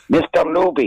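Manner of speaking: high-pitched mock Chinese accent; bad Chinese accent. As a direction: imitate a restaurant owner in a put-on Chinese accent. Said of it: "doing bad Chinese accents".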